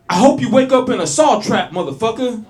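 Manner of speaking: furious shout from a car window; bellowed road-rage threat; angry yell. Retold or imitated. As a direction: yell a loud, high threat at another driver. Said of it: "he yells out".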